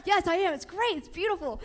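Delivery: high, gushing delight; defending her filming, thrilled; excited insisting. Retold or imitated. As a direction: gush about the shot. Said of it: "I was like".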